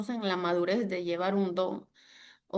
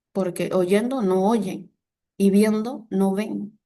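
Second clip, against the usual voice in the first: slow solemn scripture; 5 dB louder, slower than usual. Acted. Quoting Jesus' parable solemnly.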